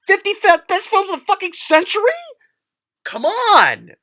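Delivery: high, loud incredulous yelling; outraged shout. Acted. Reader reacting to a best-of-century pick, outraged disbelief.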